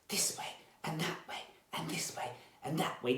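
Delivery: quick, soft, voiceless whisper. Shown as a demonstration. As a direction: whisper it briskly and lightly. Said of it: "we're gonna whisper".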